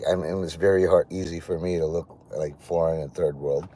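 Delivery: usual speaking voice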